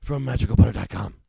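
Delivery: loud, punchy ad call to action; announcer read. Acted. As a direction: announce the number like a radio ad. Announcer reading web and number.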